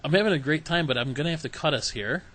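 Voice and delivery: usual speaking voice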